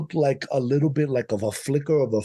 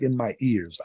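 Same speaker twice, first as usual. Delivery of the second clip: ordinary talk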